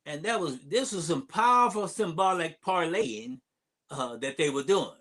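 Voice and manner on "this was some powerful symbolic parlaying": ordinary talk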